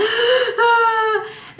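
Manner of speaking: loud, high hammed-up gasp; mock starstruck gasp. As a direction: gasp as if overcome at meeting an idol. Acts out gasping while meeting idol.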